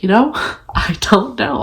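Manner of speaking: laughing softly through the words; a helpless little laugh at herself; rueful laugh. Real, not acted. Answering her mom on the phone, laughing softly.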